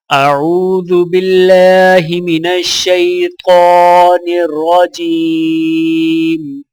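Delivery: slow, melodic tajweed chant with long drawn vowels; Quran recitation. Acted. Teacher reciting Surah Ad-Duha for students to repeat, melodic chant. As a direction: chant each verse, stretching the endings.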